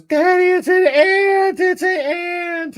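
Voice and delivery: put-on very high, slow little girl voice; imitating daughter. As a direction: play a little girl warning daddy.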